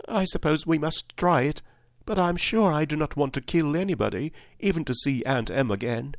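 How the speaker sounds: put-on high little-girl voice; reluctant girl, pitched well up; Dorothy. A narrator's reading. Dorothy agreeing reluctantly after drying her eyes, high and girlish. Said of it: "Dorothy dried her eyes and said".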